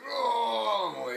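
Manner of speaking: high, loud drawn-out "ohh"; toddler's fighting yell. Retold or imitated. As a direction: imitate a straining toddler's wail. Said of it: "and he's like".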